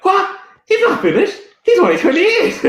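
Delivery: high, loud, laughing Scottish squawk; mock a Scottish caller's disbelief through laughter; Scottish caller, outraged. A man retells a Scottish radio caller, high-pitched laughing Scottish outrage. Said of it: "the Scottish fella ... he's like".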